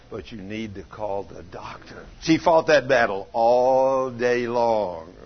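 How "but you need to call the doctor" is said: very soft, low, slow murmur of doubt; quiet inner voice tempting her; little voice. Retold or imitated. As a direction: nag gently, hushed and drawn out. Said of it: "she'd hear this little voice say".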